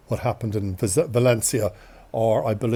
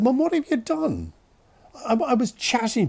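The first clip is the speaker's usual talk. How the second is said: high-pitched mock dismay; dismayed protest; 11 semitones higher than usual. Son to mother after she booked his operation, dismayed protest. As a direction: question her in high, appalled disbelief.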